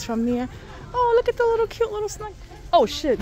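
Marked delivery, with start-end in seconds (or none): 0.9-2.3 high and fast gush; excited squeal. Real, not acted. Shopper pointing out a toy snake, fast high squeal. 2.7-3.2 loud startled outburst. Real, not acted. Shopper startled by a toy dog, loud flustered apology.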